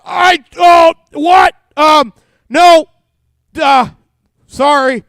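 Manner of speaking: loud, high, startled babbling; flustered startled reply. Retold or imitated. Flustered reply to wife calling.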